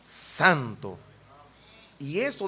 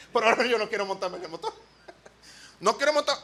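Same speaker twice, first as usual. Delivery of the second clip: laughing; 3 semitones higher, faster than usual. Real, not acted. Still laughing.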